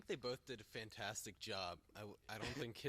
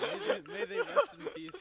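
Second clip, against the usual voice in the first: mock solemn laughing; 15 dB louder than usual. Mock eulogy for dead legends.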